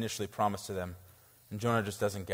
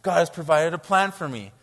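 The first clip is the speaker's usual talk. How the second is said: mock joyful; 6 semitones higher, 7 dB louder than usual. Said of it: "He's so joyful".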